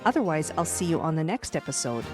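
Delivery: ordinary delivery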